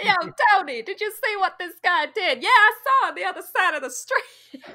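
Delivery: high, loud mock Italian-American; cartoon Italian American street banter; Italian New Yorker. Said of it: "We need an Italian New Yorker".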